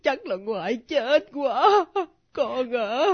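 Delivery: tearful, distressed lament; crying. Acted. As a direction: scold through sobs. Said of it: "ngoại nín đi ngoại".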